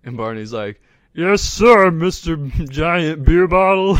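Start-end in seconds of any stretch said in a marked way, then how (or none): 1.1-3.9 put-on drunk Barney voice.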